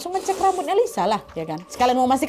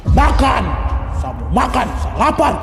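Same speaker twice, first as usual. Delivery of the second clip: possessed shout; 5 dB louder, slower than usual. Retold or imitated. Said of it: "Langsung dia teriak".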